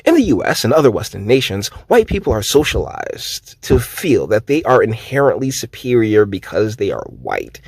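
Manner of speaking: usual speaking voice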